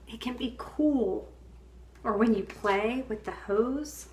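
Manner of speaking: ordinary talk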